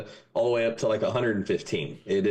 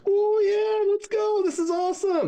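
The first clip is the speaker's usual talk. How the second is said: very high, louder whoop; ecstatic cheer; 3 dB louder than usual. Acted. Texas boys cheering the frozen hell, very high and loud. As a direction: celebrate like your team just scored. Joke, Texans cheer the cold.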